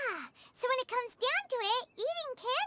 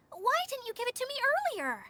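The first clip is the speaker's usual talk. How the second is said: ordinary delivery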